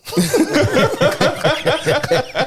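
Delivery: high creepy cackle; witch cackle. Pretend. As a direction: cackle like an old hag. Creepy laugh between lines.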